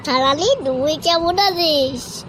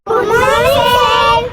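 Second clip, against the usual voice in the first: loud class greeting; 4 semitones higher, 5 dB louder than usual. Acted. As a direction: bellow it to the teacher like a pupil. Child greets teacher loudly.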